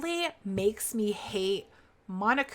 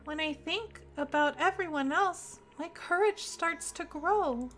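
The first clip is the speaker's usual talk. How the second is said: higher, gentle game character voice; lightly voiced shy character; 7 semitones higher, 5 dB quieter than usual. Streamer reading the shy AI's brave lines, higher voice. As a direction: read the timid AI's lines a little higher.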